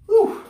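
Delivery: breathy whew of effort; sharp high whew. Real, not acted. Breathless exhale mid-workout.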